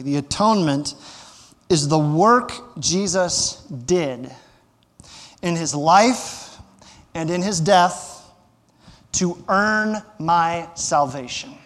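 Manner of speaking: slow, deliberate reading for note-takers; slow dictation. Shown as a demonstration. Pastor slowly dictating a definition to note-takers, slow and deliberate. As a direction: spell it out slowly so they can write. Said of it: "I'll say it slowly".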